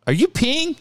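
high-pitched mock disbelief; incredulous question. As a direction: imitate a stunned employee's question, voice way up. Retelling employee's incredulous question.

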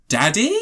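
"Daddy?" with a tentative rising end; questioning rise. Retold or imitated. Audience member offering a cheeky nickname, "Daddy?" as an unsure question. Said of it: "when there's a question mark on the end".

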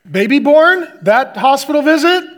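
shouted, high and thrilled; excited. Pretend. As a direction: hype it up like big news. Mock excited announcement of baby.

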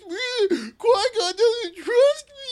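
high, slow whining cry; whiny Anakin voice. Retold or imitated. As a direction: whine it like a sulky teen. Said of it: "such a whiny fucking voice".

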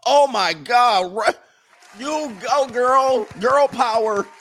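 high, loud hammed-up cheering; mock gushing praise. Friends cheering a woman's small success, mock gushing. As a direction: shriek support like an overexcited fan, exaggerated. Mimicking praise of women's posts.

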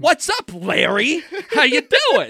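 loud, high, charged-up phone greeting; over-energetic greeting. Retold or imitated. Retelling how they answered the roommate's call with all the energy in the world, over-energetic. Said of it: "with all the energy in the world".

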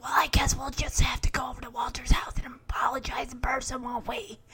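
soft whispered teasing answer; whispered reply. Retold or imitated. Host whispering Jesse's reply to Stephanie's fake laryngitis. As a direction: whisper back at her.

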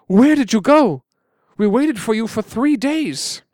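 a relieved father scolding gently; worried father. Acted. Worried father questions returning sons.

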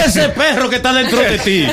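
words through laughter; laughing tease. Real, not acted. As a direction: tease him while laughing. Teasing, laughing at guest.

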